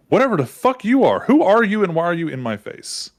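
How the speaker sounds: high, loud demand; indignant confrontation. Acted. Prince rounds on Eddie in his face, indignant. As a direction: challenge the stranger, pitch jumping up.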